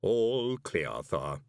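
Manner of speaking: measured report, sinking at the end; deadpan servant in a put-on voice; Igor manservant. A narrator's reading. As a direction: report like an unhurried creepy butler. Igor servant checks the street.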